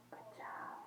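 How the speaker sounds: whispered praise to the kitten; hushed good job. Real, not acted. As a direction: breathe it softly, just air.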